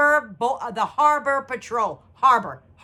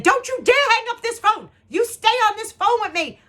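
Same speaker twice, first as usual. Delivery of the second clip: high, forceful acted order; fierce retold demand; 11 semitones higher, 4 dB louder than usual. Mother retells ordering the man to stay on, high frantic demand.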